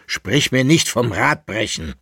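mild angry retort from the patron; snapping at him. Acted. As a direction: cut him off sharply. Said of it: "fuhr ihn der Barvitius an".

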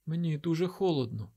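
put-on small high voice, a child complaining of cold; feverish child. A narrator's reading. Sick boy tells his father he is cold, childlike plaint.

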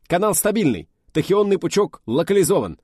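high, loud military report. Acted. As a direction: rap out the status like on deck. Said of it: "отрапортовал наш аналитик".

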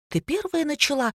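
high-pitched accusing comeback; curt retort. A narrator's reading. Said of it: "отрезала Ника".

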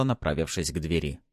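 ordinary delivery, nothing marked

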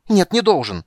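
high, loud enraged cry; furious shout. Acted. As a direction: yell it in fury. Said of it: "в ярости воскликнул Тасс".

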